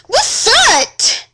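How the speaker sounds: high, loud alarmed shout; girl shouting after being hit. Acted. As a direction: cry out in shock after being struck. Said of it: "I shouted".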